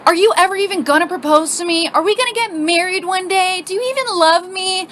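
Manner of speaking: high-pitched, hammed-up pleading; super dramatic. Girlfriend arguing about marriage, super dramatic questioning. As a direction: demand it with mock melodrama. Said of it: "super dramatic".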